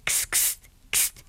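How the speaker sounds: whispered hissing kst; hissing kst sound. Acted. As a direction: hiss it softly through the teeth. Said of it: "machte es".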